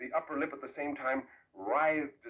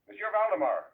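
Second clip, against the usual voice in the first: raised, higher call across the bed; urgent raised call to the dying man; calling out loud; 9 semitones higher, 8 dB louder than usual. Acted. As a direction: call his name to wake him. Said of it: "I said".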